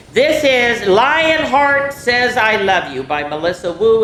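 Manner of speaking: slow, bright title announcement; title read aloud. A narrator's reading. Teacher announcing the title to children, slow and bright. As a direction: announce the book title to the kids.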